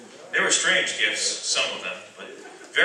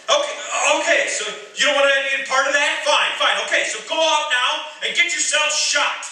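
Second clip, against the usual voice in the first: loud, high, bitter shout; angry outburst; 12 semitones higher, 4 dB louder than usual. Acted. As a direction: snap at them in frustration.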